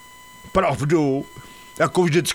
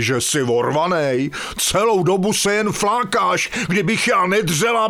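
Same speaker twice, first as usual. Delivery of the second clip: ordinary talk, nothing marked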